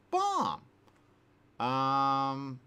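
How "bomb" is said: sudden high, loud burst; "bomb" as a high, punched climax; high-pitched exclamation. Acted. Last of three escalating bomb repeats.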